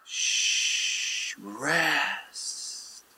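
soft, drawn-out shushing; soothing hush. Acted. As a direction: hush them gently into rest. Voicing God gently bidding rest.

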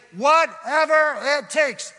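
high, loud congregation call; preacher leading the church in a loud, high shout. Real, not acted. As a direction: shout it out for all to repeat. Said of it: "Everybody say".

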